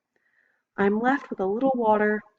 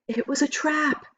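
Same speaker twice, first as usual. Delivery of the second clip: high breathless cry; 9 semitones higher than usual. A narrator's reading. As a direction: gasp it out in alarm. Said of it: "the man cries".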